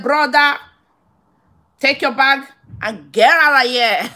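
loud, sharp order to get out; angry order out. Retold or imitated. Ordering the passenger out of her car, loud and angry. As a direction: throw him out of the car, firmly.

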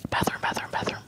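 soft whispered repetition; voiceless, playful hush. Pretend. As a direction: whisper it quickly in mock awe. Hushed repetition after gasp.

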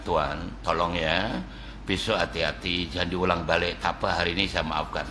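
soft and low, kindly letting him off; softly, like a forgiving officer at the roadside; gentle soft-spoken policeman. Retold or imitated. Policeman to a driver he lets off, soft and kind.

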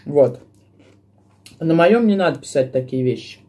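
ordinary talk, nothing marked

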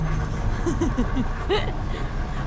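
loud airy laughter; breathy laugh. Real, not acted. Laughing over hotteok.

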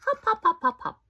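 high, loud bouncy hopping; playful hopping chant; bouncy hops. Acted. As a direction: bounce each hop out. Hopping sound effect in story.

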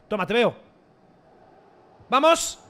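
ordinary talk, nothing marked

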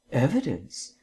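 Vance sweetly echoes Markham's word back to tease; sweetly repeated. A narrator's reading. Sweetly mocking echo. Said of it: "repeated Vance sweetly".

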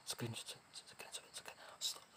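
slow, hushed urgent whisper; frightened slow whisper. Real, not acted. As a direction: whisper the plea so the ghost won't hear.

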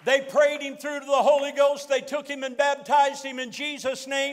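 loud, driving testimony delivery; rousing preaching. Real, not acted. Preacher tells congregation the convert's outcome, rousing full-force preaching. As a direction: build the crowd up with a triumphant testimony.

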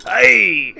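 high, loud pirate-ish "Aye!"; sudden shout. Real, not acted. Cheering over the shiv deal, loud shout. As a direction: bellow it out on impulse. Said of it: "I just shouted out "aye".

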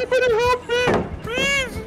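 high panicked plea for help; begging in terror, voice way up; terrified horror victim. Real, not acted. Chased by the killer, begging.

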